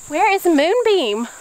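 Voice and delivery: high, drawn-out singsong question; searching call-out. Real, not acted. Looking for a missing goat.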